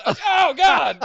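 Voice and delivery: high, loud shocked cry; shocked oh God. Retold or imitated. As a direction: gasp it out in disbelief. Reacting to a brutal bump.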